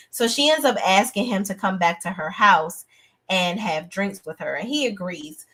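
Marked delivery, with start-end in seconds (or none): none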